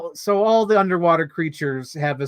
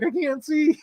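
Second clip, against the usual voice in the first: mock helpless; 10 semitones higher than usual. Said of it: "He's like".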